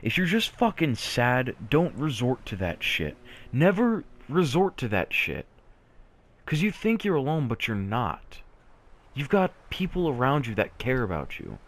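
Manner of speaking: pitched high, urgent and insistent; impassioned plea. Real, not acted. Vlogger begging sad viewers not to self-harm, impassioned plea.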